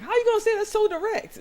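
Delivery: very high, loud incredulous squawk; mock disbelief. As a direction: exclaim it in hammed-up shock. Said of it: "someone's like".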